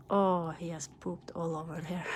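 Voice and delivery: usual speaking voice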